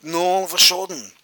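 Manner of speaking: ordinary delivery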